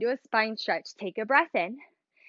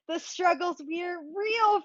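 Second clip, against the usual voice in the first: higher and straining through the last reps; winded instructor still counting; strained, out of breath; 6 semitones higher than usual. Real, not acted. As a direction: squeeze the words out mid-effort. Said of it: "I can't even talk anymore".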